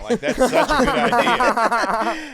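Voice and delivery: slow, high, laughing; drawn out through a big laugh. Real, not acted. Reacting to the tattoo joke, cracking up.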